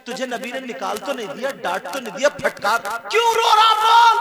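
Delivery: a mother's pleading that climbs to a high, loud cry; worried mother. Acted. Blind mother begging her weeping son to speak, rising to a cry. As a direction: beg her son to tell her why he weeps.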